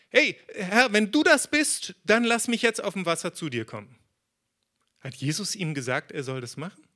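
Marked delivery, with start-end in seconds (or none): 0.1-4.0 eager call. Acted. Petrus calls out to Jesus.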